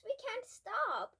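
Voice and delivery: slow loud high cry; shouting. A narrator's reading. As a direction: shout it in alarm. Boys running with robot.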